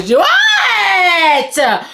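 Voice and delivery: rising into a drawn-out shriek; excited squeal. Real, not acted. Vlogger opening the wrapped books, excited squeal. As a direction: break into a squealing wail. Gleefully rips open the packaging.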